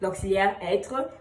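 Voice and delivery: ordinary talk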